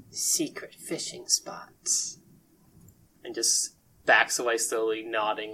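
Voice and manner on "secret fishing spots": slow, hushed whisper; secretive whisper. Acted. Sushi Kid revealing his source to adventurers, conspiratorial whisper. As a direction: whisper it slowly like a precious secret.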